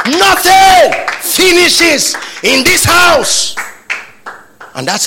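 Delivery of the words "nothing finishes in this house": very high, loud, slow shout; father's shouted declaration. Retold or imitated. Preacher re-enacting dad teaching children, slow emphatic shout. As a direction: bellow it in three separated, drawn-out chunks.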